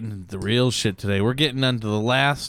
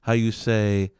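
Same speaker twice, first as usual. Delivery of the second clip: hammed-up French accent, a bit higher; mock French accent; 3 semitones higher than usual. Riffing on Pop-Tarts to his cohost in a fake French accent. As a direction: explain like a silly Frenchman. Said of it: "That's why I did that accent".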